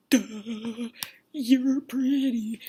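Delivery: slow, drawn-out dimwit voice; mock dopey bachelor. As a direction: drag it out like a smitten dope. Imitates dopey smitten bachelor.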